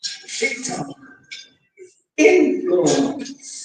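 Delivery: "is influence" stretched very slowly, words held apart; slow solemn reveal; slow dramatic quote. Retold or imitated. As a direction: let "influence" fall heavy and slow. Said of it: "He said".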